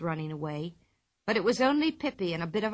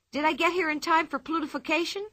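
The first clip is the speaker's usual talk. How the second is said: higher, eager question; 8 semitones higher than usual. A narrator's reading. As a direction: burst in eager to start. Pippi bursts into class.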